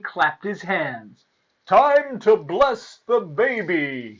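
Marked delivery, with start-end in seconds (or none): 1.6-4.2 loud, drawn-out royal proclamation; king's big, stretched-out cry. A narrator's reading. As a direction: call it out slowly to the hall. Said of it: "he cried".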